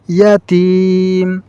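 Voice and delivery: ইয়াতিম with the তিম stretched slowly; lengthened vowel. Shown as a demonstration. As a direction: hold the তিম of ইয়াতিম long. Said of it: "এই তিম এখানে আমাদেরকে টান দিতে হবে".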